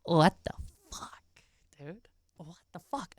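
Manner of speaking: hushed disbelief, barely voiced; shocked whisper. Real, not acted. Streamer whispering in disbelief at the apology clip, hushed. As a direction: breathe it out in stunned disbelief.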